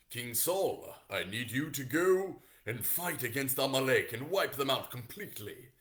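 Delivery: grand, loud British prophet; British accent. Acted. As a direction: command a king in a put-on British accent. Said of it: "the British accent did not exist yet".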